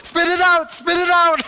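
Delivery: high, loud frantic order; freaking out. Retold or imitated. Wife ordering husband to spit out mystery drink, freaking out. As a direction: imitate a panicked wife urgently shouting. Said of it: "she's, like, freaking out".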